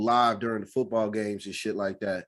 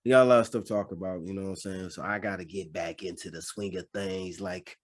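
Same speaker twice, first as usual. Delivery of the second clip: ordinary talk, nothing marked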